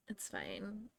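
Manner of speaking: whispered, resigned; soft whispered shrug. Real, not acted. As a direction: whisper it to yourself. Resigned aside after laughing.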